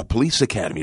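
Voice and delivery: ordinary talk, nothing marked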